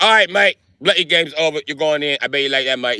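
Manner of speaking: cheeky cockney copper sneering at George; a put-on British voice taunting; British accent. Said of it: "The British was like".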